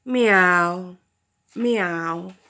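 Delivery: dismissive cat meows. Acted. Cats turning away from owl, dismissive meowing. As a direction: meow like a snooty cat. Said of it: "they simply said".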